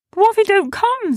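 high, loud, hurried creature voice; high-pitched anxious protest; worried Sniz. Acted. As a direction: fret that a friend is missing. Sniz worries about missing friend.

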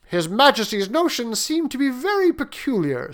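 baffled and complaining; perplexed prince. Acted.

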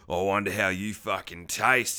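low, menacing creep voice; creepy villain. Acted. Villain intimidating a captive woman, low creepy voice. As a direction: drop deep and leer through the words. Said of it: "such a creepy character".

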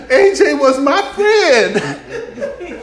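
very slow, high, laughing sob; mock-teary interview voice; grieving news witness. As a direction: wail it like a tearful neighbour on TV. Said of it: "the guy on the news saying".

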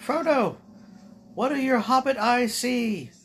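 high character voice. Acted. Voicing a character to Frodo.

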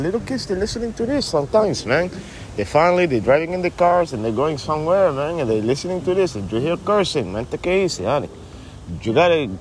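laid-back stoner buddy. Acted. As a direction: play the mellow stoner pal who ends every line with man. Buddy character scolds cursing.

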